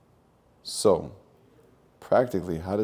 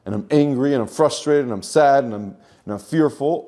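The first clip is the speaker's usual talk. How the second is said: higher, louder, whining complaint; a victim airing every grievance, louder and higher; mock self-pitying victim; 6 semitones higher, 6 dB louder than usual. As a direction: ham up a sorry-for-myself moan. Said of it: "I'm a victim to the circumstance".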